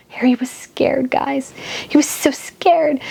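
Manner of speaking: mock-dramatic whisper. Real, not acted. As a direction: whisper it like a spooky secret. Mocking Harry's fear to viewers.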